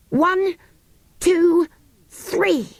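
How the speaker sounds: high, rising count to three. Acted. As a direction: build them up to the yell. Counting down before group yell.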